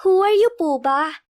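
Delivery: put-on a small girl asking, puzzled; little girl's voice. A narrator's reading. Little girl to a strange man, high child voice. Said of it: "ang tinig ng anak ay iba".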